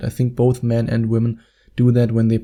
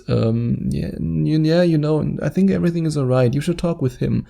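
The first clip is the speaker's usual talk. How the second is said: higher-pitched, hesitant stammering reply; uncomfortable; 4 semitones higher than usual. Acted. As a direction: stall and stumble, then rush out a reassurance. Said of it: "his best friend gets really uncomfortable".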